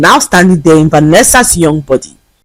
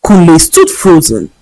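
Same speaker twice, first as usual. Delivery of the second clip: ordinary delivery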